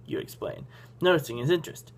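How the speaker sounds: ordinary delivery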